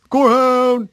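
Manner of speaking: high, drawn-out holler; loud high call, held long; mock calling out. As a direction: holler it like a playful rallying cry.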